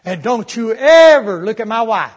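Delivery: loud, slow threatening words; angry threat. Retold or imitated. Drunk man threatening the preacher, loud and slow. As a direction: bark it as a jealous warning. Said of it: "he really throwed a good one".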